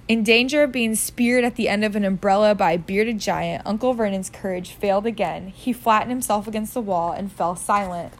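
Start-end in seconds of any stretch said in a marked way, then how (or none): none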